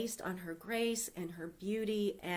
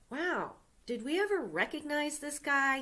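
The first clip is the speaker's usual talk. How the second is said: a high, surprised king's exclamation; lightly voiced king, struck by the news; sudden realization, pitched up; amazed king; 7 semitones higher than usual. King realizes he never rewarded Mordecai.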